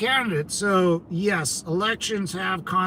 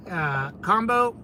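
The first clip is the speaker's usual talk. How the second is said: normal talking voice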